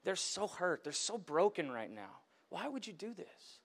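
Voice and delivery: hurt prayer sinking to near silence; broken plea to God. Retold or imitated. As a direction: ask God why, barely holding together. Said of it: "absolute anger at God".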